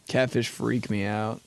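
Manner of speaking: ordinary delivery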